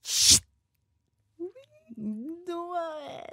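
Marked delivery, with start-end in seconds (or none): 0.0-0.4 long shushing hiss. Retold or imitated. Old woman hushing the watching family. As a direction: hush them like the old woman. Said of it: "les hace".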